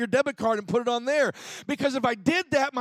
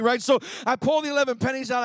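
normal talking voice

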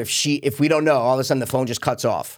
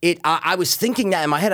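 usual speaking voice

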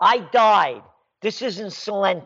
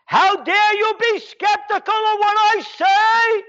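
mock Merkel voice; 13 semitones higher, 4 dB louder than usual. Mocks Merkel again.

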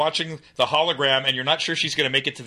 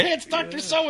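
high voice; 11 semitones higher, 3 dB quieter than usual. Retold or imitated. Voicing a movie line.

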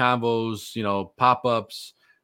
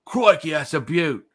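higher, louder, slow hammed-up Aussie; mock Australian exclamation, higher and louder; bad Australian accent; 7 semitones higher, 5 dB louder than usual. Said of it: "I can't do a good Australian accent".

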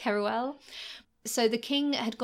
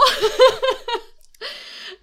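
laughing; 10 dB louder than usual. Real, not acted.

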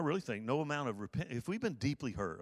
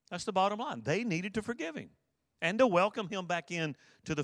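higher, pressing sermon delivery; rising preacher fervor; 5 semitones higher than usual. Acted. Pastor urging the congregation to forgive, fervently. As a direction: preach it out to the church.

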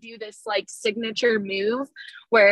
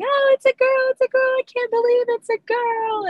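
excited screaming. Retold or imitated. Mother seeing her newborn is a girl, joyful high squeal. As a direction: shriek it out in happy disbelief. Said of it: "And I'm just screaming".

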